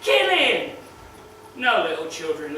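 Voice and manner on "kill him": put-on high-pitched jeering kid, shouted; mock schoolboy yell. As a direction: ape the kid's taunting scream, much higher. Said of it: "screaming at me".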